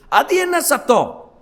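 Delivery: usual speaking voice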